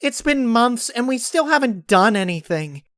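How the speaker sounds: high, loud exasperated shout; frustrated outburst. Acted. Pinkie venting at Applejack and Big Mac, loud and high. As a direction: let it all burst out. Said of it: "unable to contain it any longer".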